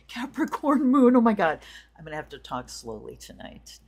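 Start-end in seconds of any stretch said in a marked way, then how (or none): 0.1-1.2 high, loud, delighted echo; excited. Real, not acted. Echoing a viewer's Capricorn moon excitedly. 1.2-1.6 excited exclamation. Real, not acted. Reacting to a viewer's Capricorn moon.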